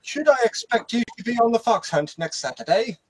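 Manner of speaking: put-on high, loud upper-class English accent; posh English accent. Joining the posh banter in posh English accent. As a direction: invite to the fox hunt in posh English accent. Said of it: "I'll get the pipe out".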